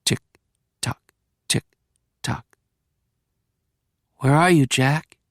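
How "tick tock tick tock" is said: softer, murmured tick-tock. Acted. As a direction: tick it off quietly like a clock. Hypnotic ticking cue.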